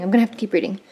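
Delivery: normal talking voice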